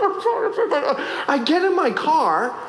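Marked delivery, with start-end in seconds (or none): 0.0-1.0 high, laughing mock apology. Pastor retreating to his car from the woman, high laughing sorry. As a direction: back away apologizing through a laugh. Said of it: "I'm like".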